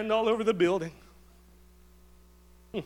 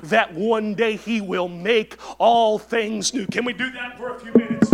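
usual speaking voice

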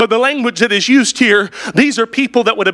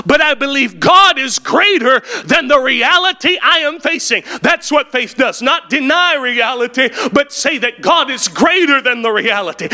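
ordinary talk, nothing marked

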